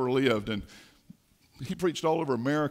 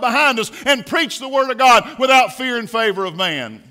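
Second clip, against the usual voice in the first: high, loud, slowed pulpit cry; preaching at full force; 9 semitones higher, 9 dB louder than usual. Real, not acted. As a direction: preach it like the church's future depends on it. Climax about the next generation.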